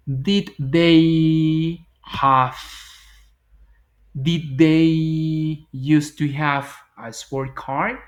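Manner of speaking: drawn out question; very slow demo. Shown as a demonstration. Teacher modelling question exercise answer, very slow. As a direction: stretch out Did they used to have slowly. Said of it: "Y entonces digo".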